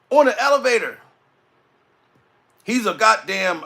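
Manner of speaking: ordinary talk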